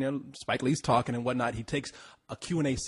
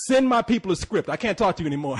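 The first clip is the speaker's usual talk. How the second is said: loud, higher, insistent brush-off; imitated firm dismissal; 7 semitones higher, 7 dB louder than usual. Spike Lee dismissing the Ethiopian woman, loud retold brush-off. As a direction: cut the person off, loud and high, as imitation.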